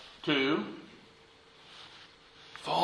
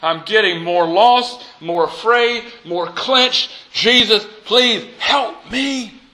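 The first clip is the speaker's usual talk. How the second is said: slow, higher, building to a cry; desperate prayer; 5 semitones higher, 3 dB louder than usual. Acted. Preacher reads Anne Lamott's prayer, rising to a cry for help. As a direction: build from frustration to an outcry. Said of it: "this wonderful prayer of Anne Lamott".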